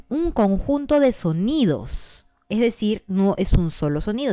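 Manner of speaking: usual speaking voice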